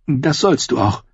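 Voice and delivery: high-pitched woman's voice, assuring; promising Cersei. A narrator's reading. Sister promising her brother answers, high assuring voice. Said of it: "versprach Cersei ihm".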